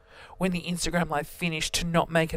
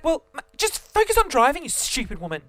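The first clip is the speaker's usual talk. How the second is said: angry; 7 semitones higher, 4 dB louder than usual. Retold or imitated. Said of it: "I was so angry at her".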